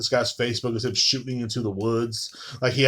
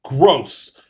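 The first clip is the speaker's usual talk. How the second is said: disgusted; 5 dB louder than usual. Retold or imitated. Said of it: "He's looking at you guys in disgust".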